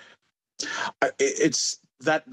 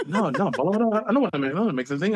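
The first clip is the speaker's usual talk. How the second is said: higher-pitched retold protest; reluctant protest; 6 semitones higher, much faster than usual. Retelling how he resisted his friend's urging, reluctant protest. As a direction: imitate yourself balking at being pushed.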